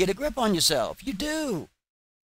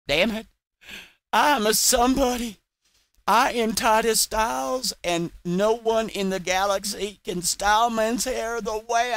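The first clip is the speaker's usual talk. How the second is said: mocking read of his tweet; 6 semitones higher than usual. Vlogger reading a rival's tweet aloud in a mildly hammed-up, higher, pompous mock voice. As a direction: ham up a self-important hairdresser's boast. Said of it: "According to Titus Stiles, he says".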